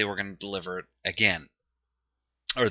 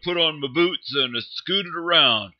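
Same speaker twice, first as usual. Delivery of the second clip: mock average Joe; 5 dB louder than usual. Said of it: "I'm just gonna be an ordinary average Joe".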